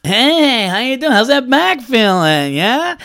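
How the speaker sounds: high, chummy doctor voice; hammed-up fake-friendly doctor; mock doctor. Mocking the late doctor, fake friendly greeting.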